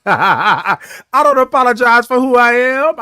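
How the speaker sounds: proud laugh as he declares. Real, not acted.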